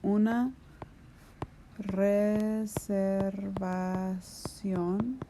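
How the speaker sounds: stretched out while writing on the board; each syllable drawn out slowly as it's written; slow dictation. Shown as a demonstration. Teacher dictating to students, word stretched slowly.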